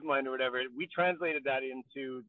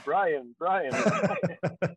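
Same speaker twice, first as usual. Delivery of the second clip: higher, louder repeated calling; looped mock calling; 6 semitones higher, 4 dB louder, much slower than usual. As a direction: call the name like a stuck loop. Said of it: "a loop of me saying".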